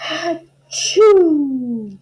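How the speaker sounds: huge high acted sneeze; big sneeze. Flea sneezing in the sleeping barn, loud sneeze. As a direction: let the sneeze explode.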